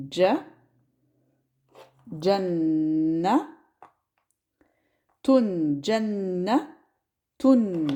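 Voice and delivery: Ja, Janna, Tun split with pauses; syllable by syllable. Shown as a demonstration. Teacher breaks Jannatun into parts for students.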